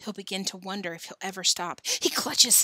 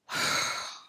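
long breathy exhale; weary sigh. Acted. Billy facing his last bite of worm, weary sigh. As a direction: let out a heavy sigh. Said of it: "Billy sighed".

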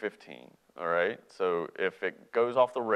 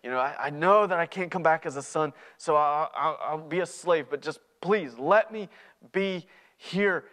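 pleading apology; 6 semitones higher, 4 dB louder than usual. Acted. Returning son apologizing to his father, pleading. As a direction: plead higher, a little halting. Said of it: "the son begins in on his apology".

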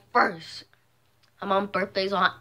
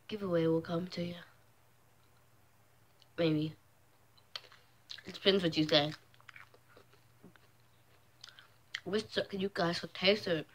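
chewing between words; talking while eating; mouth partly full; 8 dB quieter than usual. Real, not acted. Seen 0: Bit food just before; lips working while holding the piece up.

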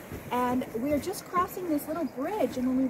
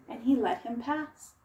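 usual speaking voice

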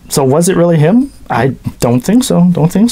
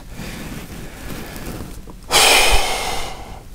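long, soft exhaled sigh; 9 dB quieter than usual. Real, not acted. Host talking about the insurance man, long sigh. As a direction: let out a long quiet breath. Host sighs after dialogue.

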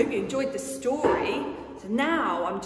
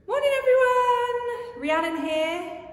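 presenter greeting; 5 dB louder than usual. Real, not acted.

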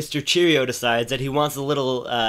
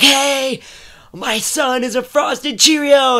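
high, loud, thrilled squeal; a proud mom shrieking the news; excited mom; 11 semitones higher, 7 dB louder, slower than usual. Retold or imitated. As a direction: mom gushing to her friends, excited. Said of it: "his mom is going crazy with excitement".

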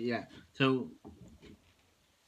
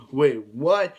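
louder, shocked wait what; a bigger, louder gasp of surprise; more shocked; 8 dB louder than usual. Shown as a demonstration. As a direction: hit it with real surprise, louder. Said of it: "I'll say it with more, you know".

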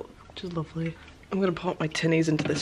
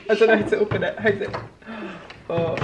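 normal talking voice

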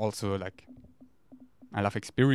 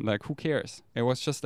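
ordinary talk

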